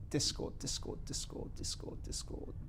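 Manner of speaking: murmured under breath, hunting; whispered search. Real, not acted. Streamer whispering to himself while searching for Discord.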